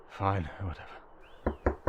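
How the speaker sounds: fed-up mutter to herself; grumbling. Acted. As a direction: sigh it out under your breath. Servant grumbles at chores.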